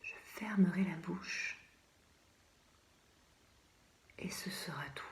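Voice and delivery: fading into a whisper; hushed finality. A narrator's reading. Condemned poet picturing his end, fading to a whisper. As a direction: let the voice die away to almost nothing.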